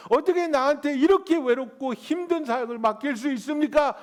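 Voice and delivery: rising to a loud, high desperate plea; anguished cry. Retold or imitated. Elijah pouring out his heart to God, loud anguished plea.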